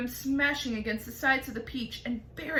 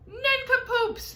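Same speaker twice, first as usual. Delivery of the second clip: high, loud slow yell; yelling insult; 14 semitones higher, 6 dB louder, slower than usual. A narrator's reading. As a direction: hurl the insult across the sky. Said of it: "he yelled".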